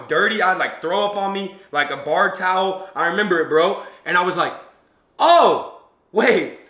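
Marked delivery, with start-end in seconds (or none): 5.2-6.6 a jolt of surprise on oh, then wait; sudden realization. Retold or imitated. Telling the crowd about a bar fan, surprised realization. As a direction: catch yourself as it dawns on you.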